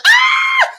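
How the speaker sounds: sudden high yelp of fright; startled scream. Retold or imitated. Ishbosheth jolted awake in bed, high startled scream. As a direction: scream as the swords come at you.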